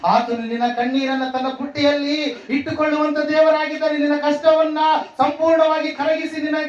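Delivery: high, impassioned assurance from the pulpit; fervent preaching. Acted. Preacher assuring the tearful that God keeps their tears, fervent preaching.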